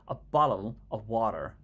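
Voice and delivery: slow American "A bottle of water" with a flapped T, "wadder"; American accent. Retold or imitated. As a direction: drawl it out like an American. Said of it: "when you speak to an American, they always say".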